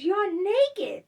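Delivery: very high, loud amazed squeal; stunned boyfriend. Retold or imitated. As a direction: gasp it out in disbelief. Boyfriend shocked she's naked.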